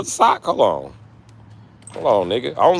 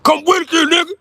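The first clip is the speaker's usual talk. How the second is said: squeaky high voice for the mad guy, a bit louder; high mock voice; 6 dB louder than usual. Mocking the mad guy in a squeaky high voice. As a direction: pitch way up to mock him.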